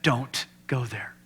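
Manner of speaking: slow, unvoiced whisper; God's whisper in the quiet room; whispered warning. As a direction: breathe the words out slowly. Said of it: "it's almost audible".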